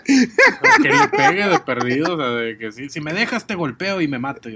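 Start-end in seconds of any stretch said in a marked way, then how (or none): 0.0-2.1 laughing through the words; high giggly burst of laughter. Real, not acted. Laughing while joking.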